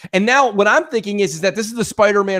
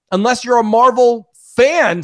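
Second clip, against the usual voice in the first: ordinary delivery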